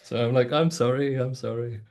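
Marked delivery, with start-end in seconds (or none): none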